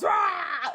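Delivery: high, loud mock roar; growl-shout like an enemy popping up; imitated roar. Buried enemy's head roars.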